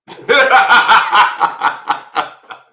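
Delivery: loud, high burst of laughter; big real laugh. Real, not acted. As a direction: crack up loudly in a long peal. Loud laugh after his boast.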